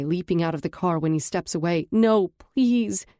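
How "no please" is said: louder, higher begging plea; pleading cry. Acted. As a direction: beg him not to leave, loudly. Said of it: "I cry".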